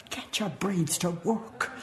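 usual speaking voice

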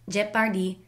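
put-on "Jeopardy" with a Filipino accent, middle vowel opened to "par"; Filipino-accented "Jeopardy", full vowels and a crisp p. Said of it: "with a Filipino accent".

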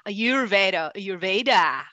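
Ayurveda drawn out with English stress; mocking the wrong Ayurveda. Shown as a demonstration. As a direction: repeat the anglicised Ayurveda. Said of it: "it is not Ayurveda".

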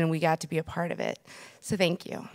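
ordinary delivery

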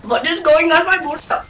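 put-on joking Indian accent. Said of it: "it's really fun talking in Indian accent".

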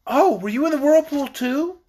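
lightly voiced eager child; eager girl. A narrator's reading. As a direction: jump in eagerly as a curious girl. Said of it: "asked Trot eagerly".

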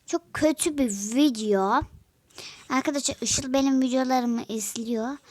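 ordinary delivery

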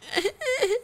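high fake wailing sob; a hammed-up crybaby wail; mock crying. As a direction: fake sob mimicking a beaten wrestler. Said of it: "il pleure comme ça".

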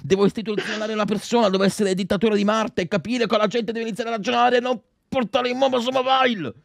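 sustained high-pitched mock tirade; outraged rant. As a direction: rant high like a hammed-up tyrant. Comic dictator rant about mobile games.

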